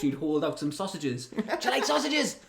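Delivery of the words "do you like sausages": high, silly dad voice with a laugh; hammed-up dad impression; imitating friend's dad. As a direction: pop out with a creepy, comic offer. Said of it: "your dad would be in a bush".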